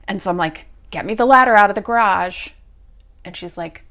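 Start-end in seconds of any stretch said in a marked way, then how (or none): 0.9-2.5 loud, fast order; impatient push to get it done; urgent demand. Retold or imitated. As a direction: bark it out, hurried and loud. Urgent request to her mother.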